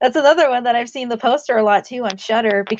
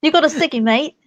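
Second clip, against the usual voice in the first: a mild British try that drifts toward Australian; Australian-ish accent; 5 semitones higher than usual. Shown as a demonstration. Friend echoes her British accent, sounds a bit Australian. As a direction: copy the British line, landing a bit Australian. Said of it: "That sounded a little more Australian".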